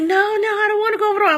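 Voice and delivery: very high and rushed, panicked pleading; frantic scream. Retold or imitated. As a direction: blurt it out fast in drugged terror. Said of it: "I'm screaming and tripping".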